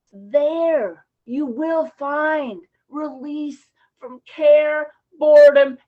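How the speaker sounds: loud, booming divine proclamation with a shout; God promising release to his suffering daughter, thundering. Retold or imitated. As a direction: call it down from heaven, slow and grand. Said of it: "That God was saying".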